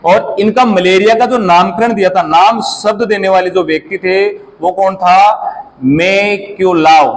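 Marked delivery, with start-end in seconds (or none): 5.8-7.2 मैक्यूलाओ said slowly, syllable by syllable; slow name demo. Shown as a demonstration. Teacher modelling a name for the class, slow. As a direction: pronounce मैक्यूलाओ slowly so the class can copy each syllable. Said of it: "बोलो मेरे साथ".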